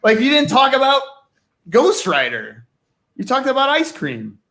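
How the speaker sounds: a hammed-up angry editor storming in. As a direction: boss barging in, half-joking outrage. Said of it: "come storming in and go".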